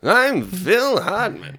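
slow, smug drawn-out celebrity voice; Jon Lovitz impression. Friend doing Lovitz doing Hartman, slow and smug. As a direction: stretch every word smugly. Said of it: "my impression of John Lovitz doing Phil Hartman".

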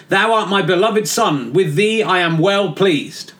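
slow, grand biblical reading; booming voice of God read slowly; solemn scripture voice. Acted. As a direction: intone it like scripture. Quoting voice from heaven.

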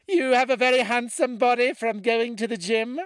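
loud, very high mock-British compliment; high, squeaky British voice. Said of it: "She would tell me things like".

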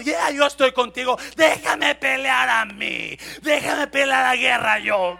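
high, shouted sermon delivery; full-force preaching. Acted. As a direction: thunder out God's reassurance to an embattled believer. (prédica a gritos, con voz aguda y potente)

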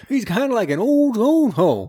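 lightly voiced old man, high and drawn out. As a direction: stretch it out high like an old fellow. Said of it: "just being kind of this old".

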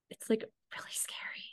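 a soft, breathy confession; almost voiceless, confiding; hushed whisper. Real, not acted. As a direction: whisper it like a secret. Confides about her scary mind.

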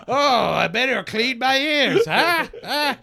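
high-pitched clown doing a bad sketch; milk the gag in a high clown voice; Krusty the Clown. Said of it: "it's like The Simpsons, Krusty the Clown".

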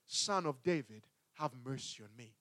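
low, soft, pleading murmur; quiet plea. Retold or imitated. Blind man repeating his plea, low and hushed. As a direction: plead it softly under your breath. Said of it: "He begins to cry".

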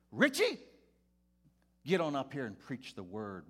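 high, loud announcement to the whole room; rally everybody in, booming and excited; pastor calling out. Retold or imitated.

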